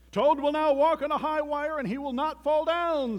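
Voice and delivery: high, loud showman's announcement; grand stage announcer calling out; strange faraway announcer. A narrator's reading. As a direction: boom it out to a whole theater. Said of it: "said the voice".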